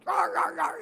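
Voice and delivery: loud high imitation barks; sharp mimicked barking; dog barking. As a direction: bark like an agitated little dog. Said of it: "Coco is barking".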